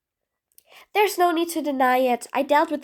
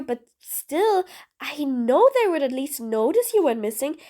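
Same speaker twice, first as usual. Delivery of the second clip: ordinary talk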